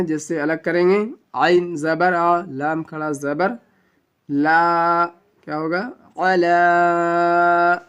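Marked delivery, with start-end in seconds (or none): 1.3-7.8 slow, letter-by-letter spelling then the syllable drawn out; spelled out. Shown as a demonstration. Teacher spelling the verse again, slow and separated. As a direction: break the word into letters slowly. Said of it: "اب پھر سے یہاں پر سے ہجے کرنے والے ہیں".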